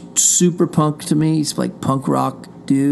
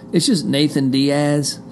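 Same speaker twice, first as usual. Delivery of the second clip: normal talking voice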